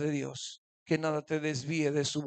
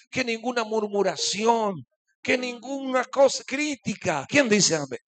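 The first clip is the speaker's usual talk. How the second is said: loud, building exhortation with a higher climb; full-force preaching; 4 semitones higher, 8 dB louder than usual. Acted. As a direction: drive each line harder to the crowd. (exhortación que va creciendo)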